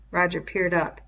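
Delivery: ordinary delivery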